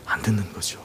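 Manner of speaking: dropped low and soft; quiet punchline. Acted. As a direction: let it fall to a hush. Sermon point, dropped voice. (낮고 조용하게 떨구듯)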